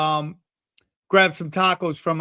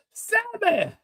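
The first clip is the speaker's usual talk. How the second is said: put-on high, reproachful old-man voice; wounded older man; 12 semitones higher than usual. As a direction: plead as a let-down pal, pitched high. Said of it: "It's how he talks".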